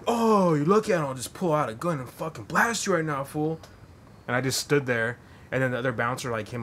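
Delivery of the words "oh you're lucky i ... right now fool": high, loud mock gangster threat; imitating a blustering tough, pitched up. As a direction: bluff a threat, hammed up. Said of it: "And he's like".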